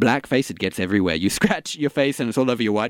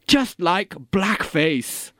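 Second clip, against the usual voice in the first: drawn out very slowly, each word weighted; savoring the punchline slowly; slow pointed emphasis; 4 semitones higher, much slower than usual. Acted. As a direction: drag out "just like blackface" as a grand point. Pompous actor drawing point.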